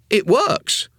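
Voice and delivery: put-on high, loud delighted boy; excited boy. A narrator's reading. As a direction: shout the discovery gleefully. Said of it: "strangled sort of growl".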